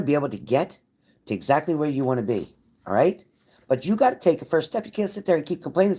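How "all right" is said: usual speaking voice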